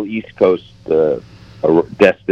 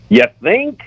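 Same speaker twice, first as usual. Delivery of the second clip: much higher, mock-sarcastic New York accent; 12 semitones higher than usual. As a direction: imitate a New York controller's "You think?" in a New York accent, pitched well up. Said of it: "that real New York accent".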